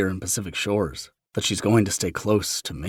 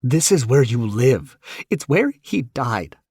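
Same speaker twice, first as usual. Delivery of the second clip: higher, slow pained voice; Elena voice; 7 semitones higher, 6 dB louder than usual. Retold or imitated. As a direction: Elena fighting back. Elena's voice in argument.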